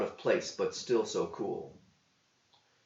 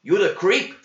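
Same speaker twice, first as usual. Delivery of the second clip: much louder, higher cook voice with a rising challenge; gruff cook; 9 semitones higher, 9 dB louder than usual. A narrator's reading. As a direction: square up to the kid. Cook confronts John.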